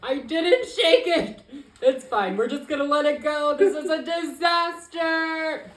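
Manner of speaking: high, loud comic panic; flustered protest. Real, not acted. Host blamed for shaking the drink, high flustered outcry. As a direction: squeal it out, stretching the exclamations.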